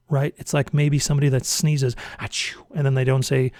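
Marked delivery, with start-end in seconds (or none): 2.2-2.6 a soft, quiet mock sneeze; just mime a little sneeze sound; muffled imitation of a sneeze; imitated sneeze. Imitating someone who sneezes.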